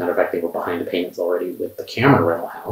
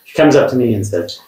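usual speaking voice

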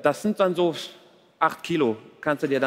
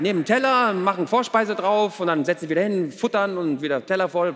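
ordinary talk, nothing marked

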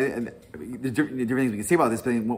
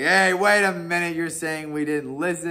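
higher, louder hey wait a minute; mock indignant protest; 8 semitones higher, 5 dB louder than usual. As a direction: act out the offended listeners.

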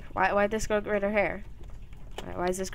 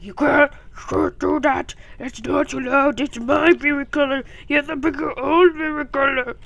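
loud, higher mock monster-child voice; imitated little sister; 5 semitones higher, 4 dB louder than usual. Retelling the sister's annoyed scolding, mock little-monster voice. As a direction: mimic a bossy little sister, pushed and loud. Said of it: "That's how she sounds, sounded when she was little".

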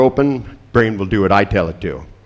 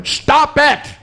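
very high, sharp mock shout; sudden yell; 3 dB louder than usual. Therapist yelling at the voice in client's head, sudden high shout. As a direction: burst out yelling to silence a voice.

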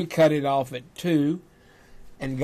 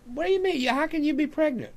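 much higher, incredulous squeak; stunned disbelief; 12 semitones higher, 3 dB quieter, faster than usual. Retold or imitated. Husband learning his wife is pregnant, in high stunned disbelief. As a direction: shoot way up in pitch, floored by the news.

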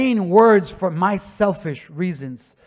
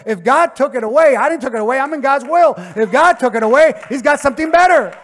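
loud urging; 7 semitones higher, 9 dB louder than usual. Retold or imitated. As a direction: shout at mom to stop crying and speak life. Urging his crying mom.

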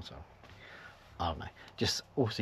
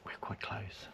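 softer, near-whispered aside; hushed; 3 semitones higher, 8 dB quieter, slower than usual. Real, not acted. Vlogger wary of the filmers overhearing, hushed. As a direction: keep it down so they don't overhear. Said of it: "They might even hear what I'm saying".

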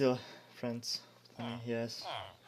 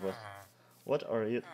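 normal talking voice

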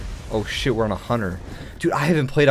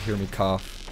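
usual speaking voice

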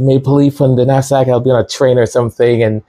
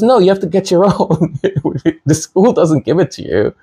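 a lightly voiced teacher, higher and amused; teacher's amused reply; 8 semitones higher than usual. Teacher answering the student, a hint of amused voice. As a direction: tease the student gently. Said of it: "He goes".

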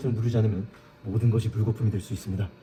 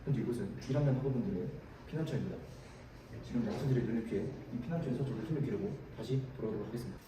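hushed nature documentary; 7 dB quieter than usual. Acted. Documentary narration of habitat.

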